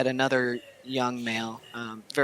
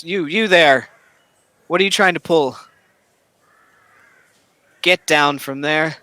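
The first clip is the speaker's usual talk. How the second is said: loud, raised challenge to an intruder; acted yell; 3 semitones higher, 8 dB louder than usual. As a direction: call out sharply, ordering someone down. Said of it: "the one in front yells".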